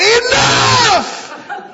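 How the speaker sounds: very high, loud yell; retold shout. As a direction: holler it, re-enacting your own outburst. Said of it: "I shouted".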